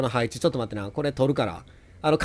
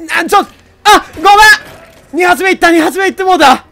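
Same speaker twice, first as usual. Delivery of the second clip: high, loud alarmed cries; loud flustered shouting; panicked yelp; 12 semitones higher, 9 dB louder than usual. Real, not acted. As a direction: yell at the mistake. Accidentally wasting second grenade.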